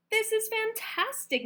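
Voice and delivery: high, loud delight; thrilled. A narrator's reading. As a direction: burst out with joy. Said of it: "Missus Pennywort said".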